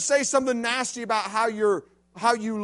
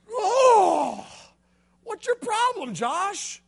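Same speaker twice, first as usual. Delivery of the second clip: a much higher, pained cry from the floor; high hurt protest; 12 semitones higher, 3 dB louder, much slower than usual. Retold or imitated. Knocked down by his buddy, a high hurt protest. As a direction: yelp at your friend like you've just been hit. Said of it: "And boom ... I'm down".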